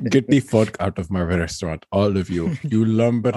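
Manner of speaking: low gruff threat to the customers; angry waiter voice. Acted. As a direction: growl it, low and menacing. Waiter suddenly throws customers out.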